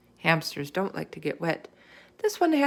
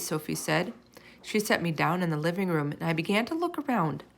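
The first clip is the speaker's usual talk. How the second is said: ordinary delivery, nothing marked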